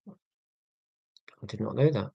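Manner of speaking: soft slow amazed murmur; quiet awe. Real, not acted. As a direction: murmur it slowly in wonder. Reacting to language fact.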